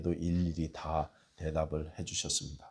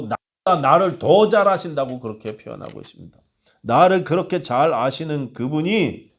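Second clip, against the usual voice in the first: louder, higher, fervent sermon voice; preaching at full force; 6 semitones higher, 9 dB louder than usual. Acted. Pastor preaching to his congregation, full force. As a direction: preach it out to the hall.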